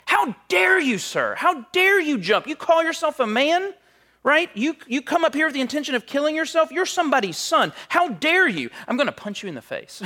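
Mister Chan's angry yelling. Retold or imitated. Rescuer berates the man who tried to jump, high furious yelling. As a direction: shame him at the top of your voice. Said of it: "He starts yelling at the person".

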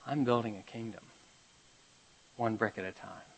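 soft, low, smiling reply; gentle answer. Retold or imitated. Smiling bricklayer answering the curious kid, soft and tender.

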